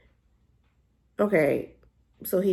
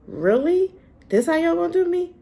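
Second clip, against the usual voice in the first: mock betrayal; 10 semitones higher, 4 dB louder than usual. Real, not acted. Complaining to writers again.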